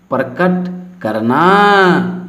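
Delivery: normal talking voice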